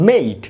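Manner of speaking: মেইট with gliding "ay", silent e; long A word. Shown as a demonstration. Teacher sounding out spelled word, long-vowel মেইট. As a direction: say মেইট as one syllable, "mayt". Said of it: "প্রথম ভাওয়েলটি উচ্চারিত হবে".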